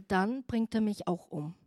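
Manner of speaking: ordinary talk, nothing marked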